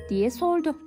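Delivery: ordinary delivery, nothing marked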